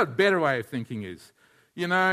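ordinary talk

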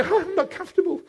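high, soft, fussy complaint; small, hammed-up grumble; mock whining. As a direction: mimic a pampered whiner. Mocks a complaining comfort seeker.

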